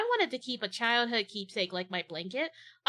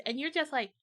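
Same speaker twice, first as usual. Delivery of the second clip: usual speaking voice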